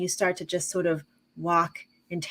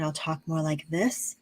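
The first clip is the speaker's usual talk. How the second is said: softer, slightly lowered grounded voice; grounded diaphragm voice; 3 semitones lower, 7 dB quieter than usual. Shown as a demonstration. Showing how she talks around her granddaughter, grounded and soft. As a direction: let the breath drop and speak softly from the diaphragm. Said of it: "bring my breath down into my diaphragm".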